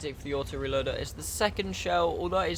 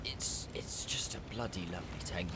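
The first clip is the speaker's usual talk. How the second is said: whisper; 6 semitones lower, 11 dB quieter than usual. Real, not acted. Affectionate aside about the tank.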